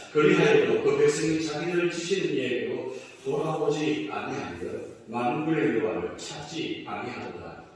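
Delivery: loud, chanted recitation of scripture; unison scripture reading. Acted. Pastor leading the congregation in responsive reading, loud chant. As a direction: read it out together like a congregation.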